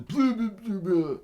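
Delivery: very high, loud mocking blah-blah; mock babble. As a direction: parody the heckler's noise. Mocking Hoffman's jeering.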